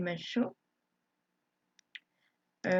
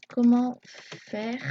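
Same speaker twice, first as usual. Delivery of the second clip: very slow, louder and higher; drawn-out, raised typing-aloud voice; dictating while typing; 4 semitones higher, 7 dB louder, much slower than usual. Real, not acted. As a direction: stretch each word as you type it.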